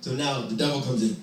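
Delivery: plain reading voice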